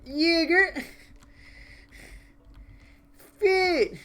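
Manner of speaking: high mock French-Italian accent; silly foreign voice. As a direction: squeak the word out in a fake French accent. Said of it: "That sounded French".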